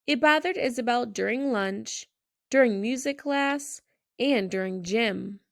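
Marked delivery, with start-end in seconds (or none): none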